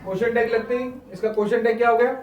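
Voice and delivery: usual speaking voice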